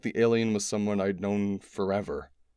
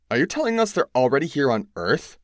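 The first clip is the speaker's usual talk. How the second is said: higher, louder pointed question; demanding; 6 semitones higher, 6 dB louder than usual. A narrator's reading. Rachel demanding whether aliens are on Earth, sharp and loud. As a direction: demand it straight out. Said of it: "Rachel demanded".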